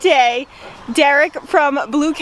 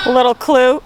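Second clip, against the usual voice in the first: ordinary delivery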